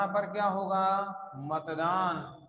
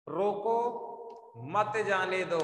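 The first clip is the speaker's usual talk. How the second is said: "रोको", long pause, "मत जाने दो"; pause after रोको. Shown as a demonstration. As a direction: leave "रोको" hanging, then read "मत जाने दो". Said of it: "आप अगर इसको पढ़े तो".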